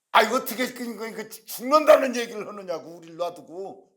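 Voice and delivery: acted flustered scolding, high and loud at first; agitated protest. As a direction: protest in agitation, stumbling over words. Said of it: "이러면서 막 흥분을 하면서". (흥분해서 따지는 목소리)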